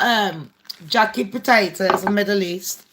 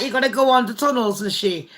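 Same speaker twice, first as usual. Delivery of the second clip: ordinary talk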